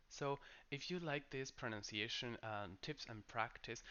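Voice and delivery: usual speaking voice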